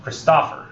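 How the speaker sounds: Christopher as chris-TOFF-er; wrongly stressed Christopher, weight on the TOPH; stress on second syllable. Shown as a demonstration. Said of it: "changing around where I put the stress".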